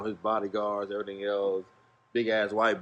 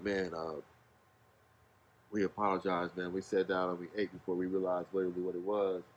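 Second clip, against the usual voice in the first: softer, lower, embarrassed; sheepish apology; 6 semitones lower, 8 dB quieter than usual. Retold or imitated. Apologizing to Travis for the meal, softer and lower. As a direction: apologize quietly to the manager. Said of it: "We were like".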